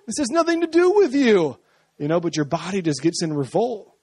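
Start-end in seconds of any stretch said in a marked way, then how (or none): 0.1-1.6 high, loud, baffled mock question; exasperated question. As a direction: scold your fingernails in disbelief. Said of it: "And you're like".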